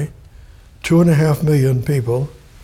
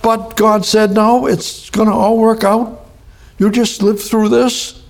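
high, ringing, urgent preaching; fervent preaching; 7 semitones higher than usual. Acted. Preacher to congregation, full-force fervent preaching. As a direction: lift the whole church with it.

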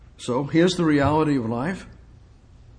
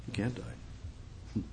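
slow whisper; 15 dB quieter, much slower than usual. Shown as a demonstration. Preacher hushing the congregation, slow whisper. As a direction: whisper it slowly, almost no voice.

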